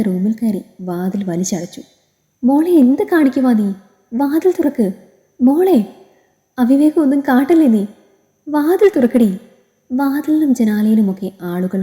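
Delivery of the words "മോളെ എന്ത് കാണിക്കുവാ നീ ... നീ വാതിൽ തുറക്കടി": high-pitched, urgent mother's plea; alarmed calling out. Acted. Mother begging daughter to open the locked door, alarmed. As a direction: call anxiously through a shut door.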